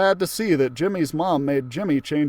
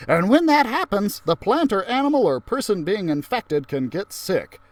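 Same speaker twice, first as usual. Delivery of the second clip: cheerful teacher voice; 6 semitones higher, 5 dB louder than usual. Acted. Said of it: "the Frizz told us".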